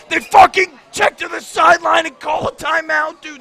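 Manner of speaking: loud, high-pitched outburst of disbelief; angry shout. Real, not acted. Co-host fuming over his team calling a timeout, angry shout. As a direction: yell it in furious exasperation.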